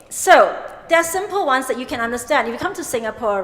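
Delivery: ordinary talk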